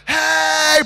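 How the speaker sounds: loud, high, excited "Hey!"; hyper guy. Retold or imitated. Hyper guy bouncing around the dance, loud and excited. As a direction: burst in like a hyper kid. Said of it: "The guys that just are everywhere".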